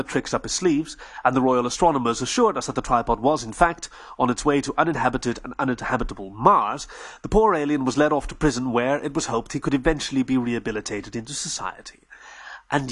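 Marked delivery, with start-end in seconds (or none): none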